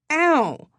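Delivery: high, loud "ow"; pained yelp. A narrator's reading. Jabbed mid-rant by her friend, sharp high yelp. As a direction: yelp from a sudden jab.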